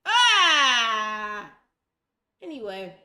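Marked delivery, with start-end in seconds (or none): 0.1-1.5 sharp loud cry of frustration; frustrated shout. Real, not acted. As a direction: let out a loud fed-up cry. Program fails to open.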